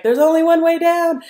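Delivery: high, loud called-out answer; coach calling up. Retold or imitated. Coach calling up from the eddy, loud. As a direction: shout it up to her, matter-of-fact. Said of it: "He's like".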